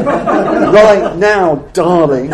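high, loud, laughing through the catchphrase; laughing mock order. Calling for food quickly in the restaurant, laughing. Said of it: "We'd say".

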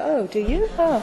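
mild mock surprise. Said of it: "I sort of chose to go".

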